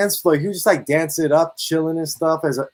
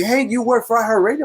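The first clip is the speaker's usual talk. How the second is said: high, eager stranger's question; excited fan; 8 semitones higher than usual. Retold or imitated. Fan spots my hoodie, eager high question. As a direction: play the starstruck fan spotting the hoodie.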